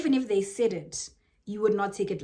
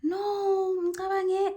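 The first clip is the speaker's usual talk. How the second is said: mock timid reply; 10 semitones higher, much slower than usual. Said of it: "It's always like".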